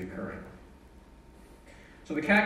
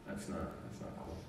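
very soft, voiceless understatement; hushed, retold dismay; subdued dismay; 5 semitones lower, 12 dB quieter than usual. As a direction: mutter it low to yourself, letdown. Retold reaction to bad news.

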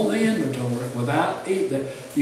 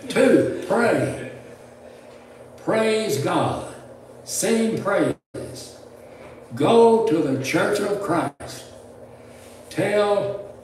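slow, raised, ringing read-out; deliberate, spaced-out pulpit proclamation; reading a list aloud; 5 semitones higher, much slower than usual. Real, not acted. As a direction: announce each point and let it stand. Reads granddaughter's numbered list aloud.

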